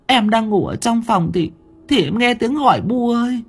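high, far-off crying wife's voice; a terrified wife half-sobbing to her husband; whimpering wife. A narrator's reading. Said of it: "Bà Hường mếu máo". (giọng vợ mếu máo, cao vút, sợ hãi)

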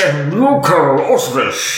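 slow, drawn-out theatrical boom; Brian Blessed. Acted. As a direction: stretch it out like a grand stage actor. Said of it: "more of a Brian Blessed".

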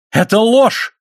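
high-pitched outburst cutting in; sudden high shout of denial; indignant cry. A narrator's reading. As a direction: cry out against a false accusation. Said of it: "крикнул Лайонель".

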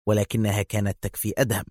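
plain reading voice

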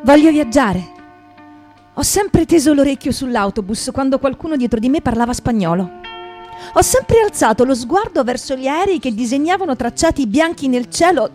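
ordinary talk

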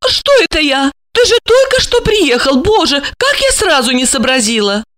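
high worried female voice; flustered. A narrator's reading. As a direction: catch yourself in a fuss. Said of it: "забеспокоилась".

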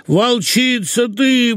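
high, very slow wail; drawn-out whining. A narrator's reading. Husband calls his wife names, wailing slowly. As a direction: draw each word out in a high whine. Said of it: "в том же тягучем тоне".

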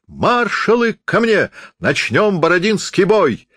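wild bravado. A narrator's reading. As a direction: bellow defiance at death. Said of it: "Страшно захохотал Наполеон". (дерзкий театральный крик)

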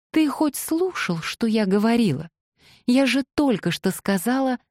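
high-pitched, indignant scolding; exasperated reproach. Acted. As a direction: scold him for not listening. Acted exasperated reproach in dialogue.

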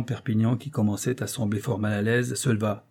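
plain reading voice

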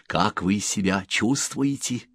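plain reading voice

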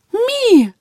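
high, drawn-out amazed cry; astonished. A narrator's reading. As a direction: stretch it out in awe. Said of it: "протянул поражённый Элькат".

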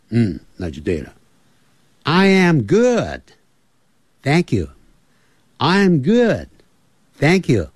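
ordinary talk, nothing marked

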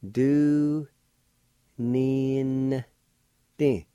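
teacher sounding a word out slowly; syllable by syllable. Shown as a demonstration. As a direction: stretch each syllable out with long pauses between. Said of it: "like this".

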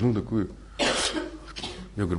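normal talking voice

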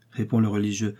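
ordinary delivery

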